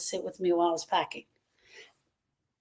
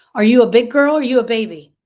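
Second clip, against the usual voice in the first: put-on louder, scolding taunt at a child; taunting parent; 3 semitones higher, 8 dB louder than usual. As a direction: shame the child like a harsh parent. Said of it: "looks a lot like this".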